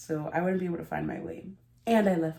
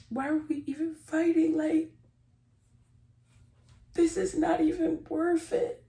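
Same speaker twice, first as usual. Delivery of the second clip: teary high plea; 10 semitones higher than usual. Retold or imitated. Herself in the reconciliation.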